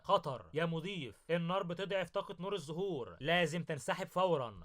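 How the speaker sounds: plain reading voice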